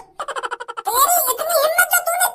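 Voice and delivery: angry outrage. Acted. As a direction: confront him for breaking uncle's cart. Nephew confronts the goon. (गुस्से में धीमी, ऊँची ललकार)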